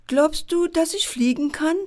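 put-on little girl voice. A narrator's reading. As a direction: ask it as an eager little girl. Girl Daniela voiced in story.

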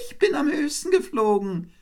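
very high, triumphant little-bird voice; calling wren. A narrator's reading. Wren claims victory over the eagle, calling out high. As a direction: crow your victory in a tiny high voice. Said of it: "rief der Zaunkönig".

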